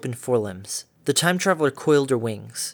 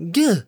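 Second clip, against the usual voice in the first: startled yelp; 9 semitones higher, 6 dB louder than usual. Acted. Derpy nearly bitten.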